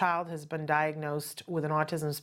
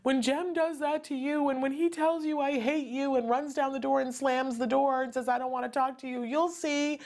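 mimicking the friend; 8 semitones higher than usual. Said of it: "and she said".

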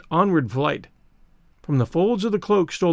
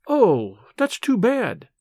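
exclaimed; 5 semitones higher, much slower than usual. A narrator's reading. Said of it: "she exclaimed".

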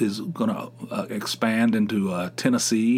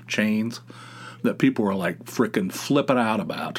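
ordinary talk

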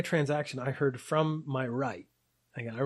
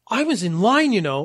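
higher, louder mock-indignant snap; loudly protest, imitating an affronted customer; indignant outburst; 7 semitones higher, 8 dB louder than usual. Indignant lady confronts him for walking past her, loud indignant protest.

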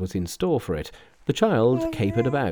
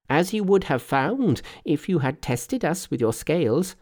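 lightly voiced Monkey, pitched up; confident Monkey; 7 semitones higher than usual. A narrator's reading. Monkey telling the old man of the match, lightly cocky. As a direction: boast lightly in a higher voice.

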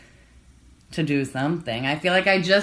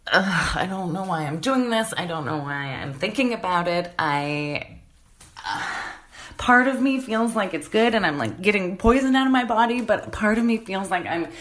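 tired, hushed self-talk after writing at four a.m. Real, not acted. Recorded emotional diary moment.

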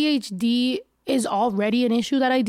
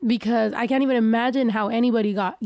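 ordinary talk

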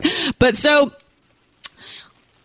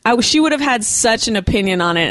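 ordinary talk, nothing marked

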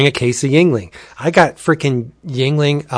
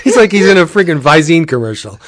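laughing while talking, bit louder; 4 semitones higher, 3 dB louder than usual. Real, not acted. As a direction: laugh through the whole line. Laughing at friend's deadpan.

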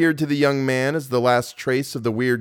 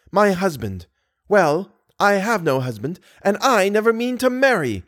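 high, emphatic proud retort; haughty; 6 semitones higher than usual. A narrator's reading. Girl tells suitor he has no rights, haughtily. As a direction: assert your independence in a raised pitch. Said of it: "said the young girl haughtily".

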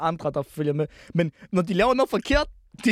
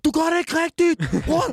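high, drawn-out mock complaint; exaggerated retold scolding; 10 semitones higher, 4 dB louder, slower than usual. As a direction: imitate someone telling you off, high and slow. Acting out Papito scolding him.

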